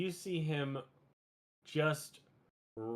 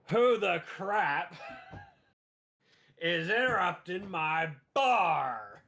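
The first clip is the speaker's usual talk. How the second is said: slow, loud captain's bellow; angry shout; 7 semitones higher, 6 dB louder, slower than usual. Acted. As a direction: roar at the intruders in his bar. Gnarly captain bursting into bar.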